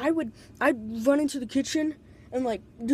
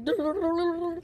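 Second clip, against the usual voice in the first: rapid rolled babble, pitched up; Scooby-Doo running sound; 5 semitones higher than usual. Retold or imitated. Stoner imitating cartoon running sounds for listeners, high babbled noise. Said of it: "I'd hear Scooby-Doo sounds of the running".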